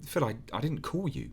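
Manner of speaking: a hint of a hurt woman on the phone; Sarah's voice.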